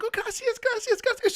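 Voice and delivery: very high imitated thank-you in Spanish; imitating a sweet, thankful woman; sweet grateful lady. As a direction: gush thanks in a tiny high voice. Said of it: "She was so sweet".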